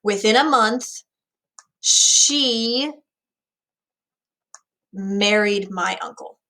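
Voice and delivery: long breaks around SHE, as if the thought won't finish; halting, upset. Acted. Teacher acting Hamlet unable to finish his thought, halting. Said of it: "He can't finish this thought. It's so upsetting".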